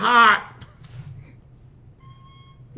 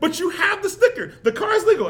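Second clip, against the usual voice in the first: higher-pitched imitation of a wife; protesting wife; 6 semitones higher than usual. Wife arguing about the car sticker, imitated in high protest. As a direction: play the wife insisting, pitched well up. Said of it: "And she's like".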